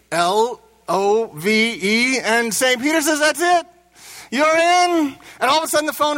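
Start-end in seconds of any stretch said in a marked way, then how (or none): 0.1-2.2 slow, careful spelling, each letter apart. Acted. Boudreaux spelling the word for Saint Peter, slow and careful. 3.2-5.1 high, triumphant verdict; delighted announcement. Acted. Saint Peter letting Boudreaux into heaven, delighted.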